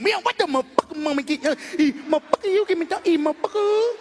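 rising high angry demand; brother impression. Brother cursing at the comedian for food, high rant.

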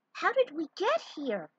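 high, wondering princess voice; puzzled princess. Acted. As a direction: ask it as if totally lost. Zelda lost on racetrack.

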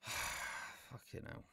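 breathless whispered sigh of disbelief; a weary whispered curse; muttered curse. Real, not acted. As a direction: sigh it out under your breath, fed up. Exasperated aside about stupid plot.

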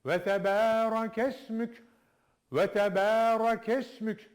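Ve tebareke ismük drawn out with the k joined to s; slow recitation. Shown as a demonstration. Teacher reciting the joined phrase for students. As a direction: join kes to mük slowly. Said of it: "K'yi bağlayalım S'ye".

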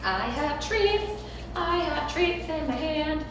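high, slow, sing-song coaxing. Real, not acted. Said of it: "see if I can coax him".

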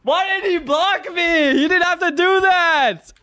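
high-pitched, furious wail of complaint; real high, drawn-out protest; outraged yelling. Real, not acted. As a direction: yell it in aggrieved disbelief. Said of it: "Why are you yelling?".

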